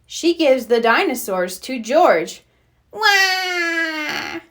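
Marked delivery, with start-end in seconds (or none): 2.9-4.4 put-on toddler wail. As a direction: wail like a toddler whose toy was taken. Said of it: "cries Richard".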